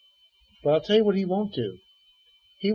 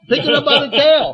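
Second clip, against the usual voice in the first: higher and much louder, booming the repeat; insist, like he won't say it a third time; louder insistent command; 7 semitones higher, 11 dB louder than usual. Acted. God repeats command, Moses balks.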